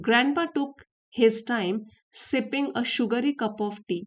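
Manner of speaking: slow, deliberate reading of the English text; story reading. Acted. Teacher reads the story to her students, slow and careful. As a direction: read each phrase out slowly for learners.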